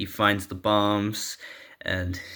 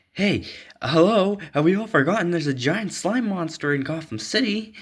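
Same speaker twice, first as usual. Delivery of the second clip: much higher, sarcastic "hello"; exasperated Dick; 8 semitones higher than usual. Acted. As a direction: point out the obvious, incredulous. Dick interrupts the argument.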